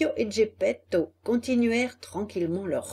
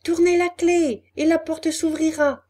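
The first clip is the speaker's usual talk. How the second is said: high little voice; small voice; 7 semitones higher, 6 dB louder than usual. A narrator's reading. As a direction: answer like a tiny hidden creature. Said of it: "dit la même petite voix".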